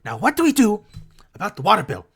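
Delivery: high, loud put-on goblin voice; heated goblin. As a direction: argue loudly as an agitated goblin over household money. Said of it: "they're having a heated discussion".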